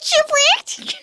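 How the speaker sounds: squeaky high chipmunk voice; high cartoon chipmunk squeak. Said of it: "Do it again".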